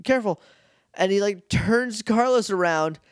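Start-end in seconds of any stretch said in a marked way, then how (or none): 0.0-0.4 higher, anxious warning; panicky Justin. Retold or imitated. Justin warning Carlos about the robots, higher and anxious. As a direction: fret to your friend in a raised voice. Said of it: "He's like".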